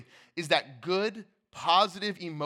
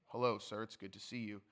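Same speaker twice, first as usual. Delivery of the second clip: dry calm greeting; 8 semitones lower, 11 dB quieter than usual. Retold or imitated. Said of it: "don't address Jesus dryly or calmly".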